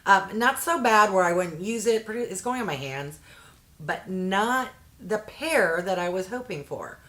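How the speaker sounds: ordinary delivery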